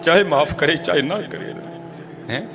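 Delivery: low, hushed, tearful admission; tearful master. Acted. Weeping master admits only God can forgive, low and soft. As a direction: say it through tears, quietly. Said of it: "مالک رو پڑا".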